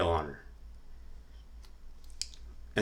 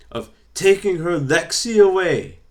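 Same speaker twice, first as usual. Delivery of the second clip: much higher, louder Scottish accent, playful imitation; 10 semitones higher, 9 dB louder than usual. Imitating Nikki Cross accusing Bray, loud high Scottish accent. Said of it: "let's see if we can do this Scottish accent".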